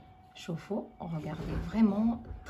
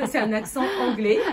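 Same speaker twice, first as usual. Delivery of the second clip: laughing; 4 semitones higher, 9 dB louder than usual. Real, not acted. Gushing over client's English accent.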